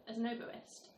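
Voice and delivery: normal talking voice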